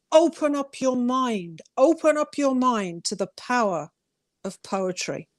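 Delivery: usual speaking voice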